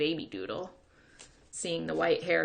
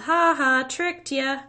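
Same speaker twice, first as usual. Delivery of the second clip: loud, high jeering laugh; boy calling out to mock the kid; taunting call; 9 semitones higher, 8 dB louder, slower than usual. A narrator's reading. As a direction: jeer as you ride off. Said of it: "and called".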